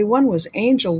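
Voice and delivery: ordinary delivery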